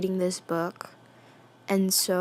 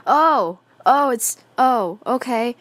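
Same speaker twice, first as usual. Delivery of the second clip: shocked surprise; 3 semitones higher, 8 dB louder, slower than usual. Real, not acted. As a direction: blurt it out, caught completely off guard. Recognizes the rescuer's name.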